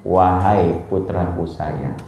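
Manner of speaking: an affectionate Arabic-style endearment said slowly; tender address. Shown as a demonstration. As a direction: call him gently, like a dearly loved child. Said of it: "disapa dengan wahai putraku sayang".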